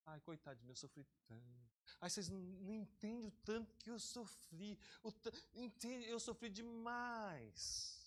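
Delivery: soft, slow, whining mock victim; mock a self-pitying sigh, drawn out; whiny self-pity. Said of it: "a pessoa que fica falando muito do passado dela".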